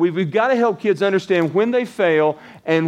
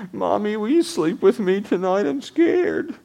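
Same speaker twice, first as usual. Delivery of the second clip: put-on small boy's voice, pitched higher, pleading; a frightened child begging not to be alone; scared little boy; 7 semitones higher than usual. Pastor voicing a scared boy asking mom to stay, higher and pleading. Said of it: "this shaky little voice".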